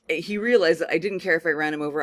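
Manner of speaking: usual speaking voice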